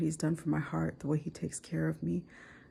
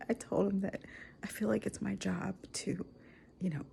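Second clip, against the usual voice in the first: normal talking voice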